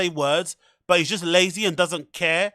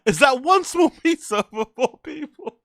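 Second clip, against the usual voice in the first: laughing disbelief; 6 semitones higher than usual. Retold or imitated. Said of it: "I was like".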